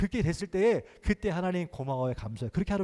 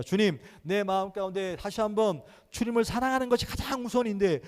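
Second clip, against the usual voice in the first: raised, driving prayer voice; fervent loud prayer; 5 semitones higher, 6 dB louder than usual. Acted. Pastor leading the church in loud prayer, fervent. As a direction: pray aloud with full fervor.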